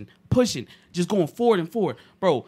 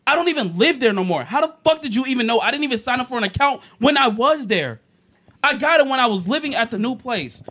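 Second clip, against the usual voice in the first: exasperated retold rant; 7 semitones higher, 8 dB louder than usual. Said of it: "I'm like".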